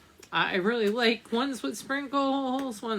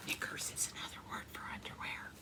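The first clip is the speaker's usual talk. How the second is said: whisper; 17 dB quieter, much faster than usual. Real, not acted. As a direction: drop to a quick whisper. Whispered aside to young listeners.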